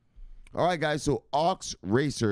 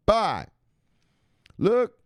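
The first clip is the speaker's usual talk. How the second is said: sign-off call; 12 semitones higher, 5 dB louder than usual. Acted. Signature sign-off call to chat.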